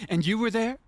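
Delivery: tearful-sweet Dorothy, pointing out each friend; Dorothy telling her friends they were there, mock earnest; Wizard of Oz Dorothy. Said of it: "it's giving Wizard of Oz".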